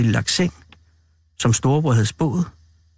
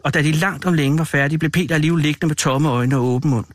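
ordinary delivery

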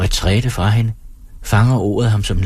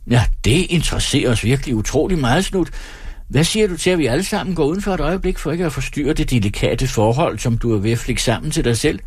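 lightly voiced showman, teasing patter; quick, higher start that settles down; mocking stage performer; 6 semitones higher than usual. Performer teases a woman before his audience, mock-interested.

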